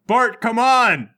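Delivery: loud, drawn-out exasperated call; urgent shout. Acted. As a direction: holler to hurry up a lagging teammate. Urging Bart to flee.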